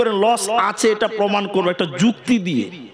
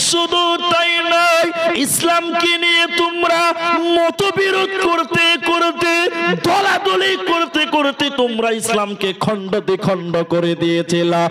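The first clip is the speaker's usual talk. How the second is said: impassioned preaching; 8 semitones higher, slower than usual. Acted. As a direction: hammer it home from the pulpit. Fiery preaching climax.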